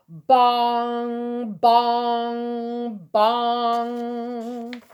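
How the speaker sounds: booming bell bongs; bell sound. A narrator's reading. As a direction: ring out like a big church bell. Bell ringing in story.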